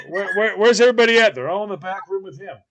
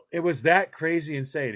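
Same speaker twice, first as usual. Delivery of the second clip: ordinary delivery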